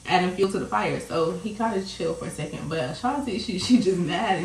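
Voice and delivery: ordinary delivery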